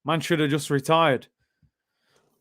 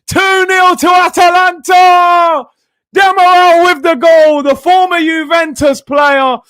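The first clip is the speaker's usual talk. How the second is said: real full-throated goal shout, much higher and louder; goal celebration; 13 semitones higher, 11 dB louder, slower than usual. Real, not acted. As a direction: scream the goal like a gleeful fan. Rival team scores, live reaction.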